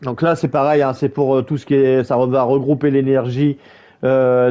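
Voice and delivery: ordinary talk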